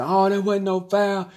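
taunting a referee in a put-on heckler's voice; mock parent heckling. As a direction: play an indignant parent berating the ref. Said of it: "a parent in the stands yell at him".

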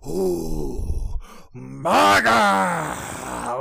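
drawn-out, loud cry of the name; ridiculous wrestling vocalization. Retold or imitated. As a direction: imitate an over-the-top wrestling holler, stretched long. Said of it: "fucking ridiculous vocalizations. ... Like, just that".

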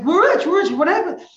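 high, loud excited cry; excited shout. Acted. Wife tells her waking husband the news, excited cry. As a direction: burst out with the news.

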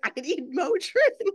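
high mock whine through laughter; amused little-kid impression; imitated toddler. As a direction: copy a little kid's wail while laughing. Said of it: "That is so your daughter".

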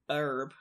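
"herb" with the H dropped, like "erb"; American pronunciation. Shown as a demonstration. Host showing how most Americans say it, "herb" without H. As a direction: say "herb" the American way, silent H, low and soft. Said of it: "most Americans obviously say".

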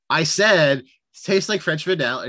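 mock-insist, as if not heard; retold emphatic repeat. Film character repeating himself to puzzled friend, mock insistent.

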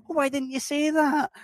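very high-pitched protest; hammed-up squeaky complaint, as if baffled; mock exasperation. Traveller given wrong directions protesting to a local, mock exasperation. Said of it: "And you're like".

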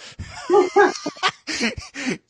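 high burst of laughter; real laughter. Real, not acted. Son cracks up at the dazed-player act, real laughter. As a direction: crack up mid-chat.

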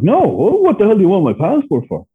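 high, loud retold panic; acted-out startled outburst; alarmed protest. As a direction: protest in mock alarm, pitch leaping up. Retold alarm at passport question.

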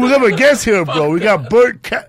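loud, high-pitched hype-man intro; hyped guest announcement. Real, not acted. Hyping up the arrival of the guest.

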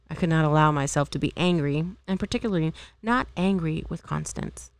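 ordinary delivery

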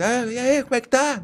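high-pitched mock yell; imitated shouting. Host mimicking someone who can't hear himself in headphones, mock shouting. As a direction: call out as if deafened by headphones. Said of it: "é, começa a gritar".

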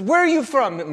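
café owner voice. Retold or imitated. Café owner questioning Marcus.

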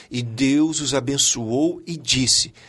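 measured, unhurried recitation; slow scripture quote. Acted. Guest returning to God's example, slow recitation. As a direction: draw out the verse word by word.

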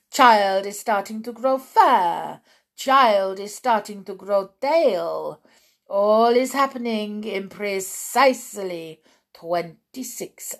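put-on loud, triumphant accented witch; Grand High Witch. Witch revealing the transformation to her followers, loud triumphant accent. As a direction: declaim each stage with mounting relish.

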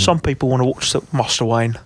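lightly done Cockney butler; hint of a Cockney movie veteran; Michael Caine. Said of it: "I can't think of Michael Caine anymore".

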